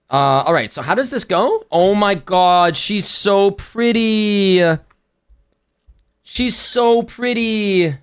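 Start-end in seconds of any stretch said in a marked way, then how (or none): 1.7-7.9 slow, drawn-out adoring gush; smitten admiration. Real, not acted. Streamer admiring his new Dragonair figure, slow smitten gushing. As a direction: stretch the words in awe.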